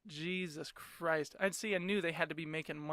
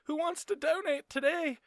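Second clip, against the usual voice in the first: high, sing-song call to passers-by; chirpy blood-drive recruiter; 11 semitones higher, 3 dB louder than usual. Retold or imitated. Mocking the blood van crew calling out, high and chirpy. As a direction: lure donors like a cheerful salesman. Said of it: "They show up in the little red van".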